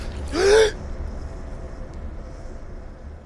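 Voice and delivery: sharp airless gasp of horror; a scream that catches as a breathless gasp; terrified gasp. Acted. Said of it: "I open my mouth to scream".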